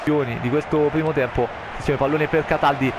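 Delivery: normal talking voice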